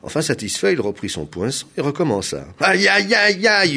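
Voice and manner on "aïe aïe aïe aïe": high, loud repeated yelps; howl of pain. A narrator's reading. Governor being pierced a second time, howling. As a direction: howl as if stabbed. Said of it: "hurla Gong Jing".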